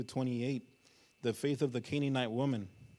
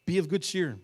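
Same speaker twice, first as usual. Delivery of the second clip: hearty call; 6 semitones higher, 10 dB louder than usual. Acted. Disciples running to Bartimaeus with news, hearty call. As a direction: open loud and high, then drop.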